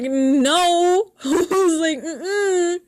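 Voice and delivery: blurting no with jealousy; loud retold no. Jealous girlfriend blurting a loud no at her friend. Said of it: "I said it so loud".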